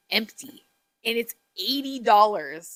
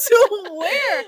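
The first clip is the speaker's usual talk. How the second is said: incredulous; 11 semitones higher, 8 dB louder than usual. Retold or imitated. Mock confused, high.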